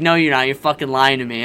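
imitating girlfriend's loud disbelief; retold disbelief. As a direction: throw the accusation back at him. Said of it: "she was like".

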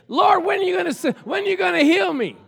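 shouted, high plea to God; loud impatient prayer; pleading prayer. Retold or imitated. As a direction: demand an answer from heaven. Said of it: "I'm over here praying".

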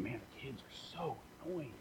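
hushed whisper of a complaint; gloomy friend whispering about his kids. Retold or imitated. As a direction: breathe it out like a weary secret.

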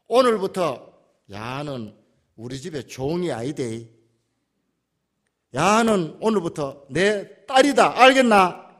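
loud, slow proclamation in Gyeongsang dialect; the general telling the other servants she is now his daughter, loud and slow; proclaiming to servants. Retold or imitated. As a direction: declare her your daughter to the whole house.

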